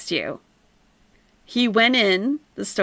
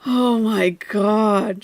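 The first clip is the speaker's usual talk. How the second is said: usual speaking voice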